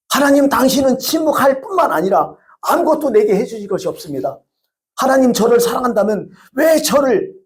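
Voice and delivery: complaining prayer. Retold or imitated. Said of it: "한마디 해요".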